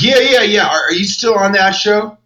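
excited and oblivious; mock bodyguard. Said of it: "It's like".

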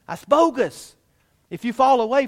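rising preacher's punch; emphatic rebuttal. Real, not acted. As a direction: slam the idea as bogus. Rebutting the view loudly.

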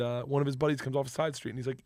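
ordinary delivery

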